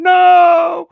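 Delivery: loud, high mock howl; huge comic cry of refusal; inner scream. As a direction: let out a hammed-up wail of NO. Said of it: "But inside, I'm".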